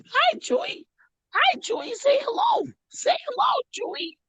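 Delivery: very high, cutesy dog talk; high-pitched cooing at a pet. Real, not acted. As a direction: coax a dog in a squeaky voice. Talking to the dog.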